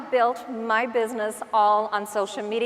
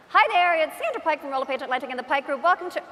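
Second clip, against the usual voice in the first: fast, higher-pitched promo introduction; put-on camera patter, pitched up; video greeting; 4 semitones higher, faster than usual. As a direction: rush brightly through a rehearsed hello. Acting out a further video take.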